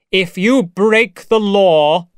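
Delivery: slow commanding threat; threatening proclamation. Acted. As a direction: declare it to a crowd, word by word. Moreau threatens the crowd.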